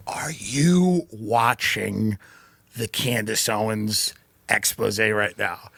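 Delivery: slow, low, hushed confidential question; retold whisper. Hotel clerk confiding in a guest in the lobby, imitated whisper. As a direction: draw it out like a secret passed in a hotel lobby. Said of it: "he's whispering in the lobby".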